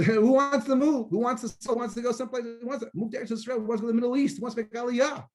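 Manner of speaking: high, mock-complaining questions; mock the people who won't move; mocking whine.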